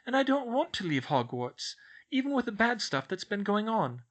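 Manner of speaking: put-on high, earnest girlish outpouring; spilling out. A narrator's reading. Schoolgirl unburdens herself to her professor, words spilling out. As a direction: pour out the worries without holding back. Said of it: "the words came spilling out".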